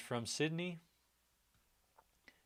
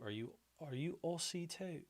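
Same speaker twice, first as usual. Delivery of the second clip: higher-pitched, softer try at Aussie; Aussie accent; 6 semitones higher, 6 dB quieter than usual. Pretend. Streamer asking an Australian viewer a question, mock Aussie accent. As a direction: attempt a tentative Australian accent. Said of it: "an Aussie accent now".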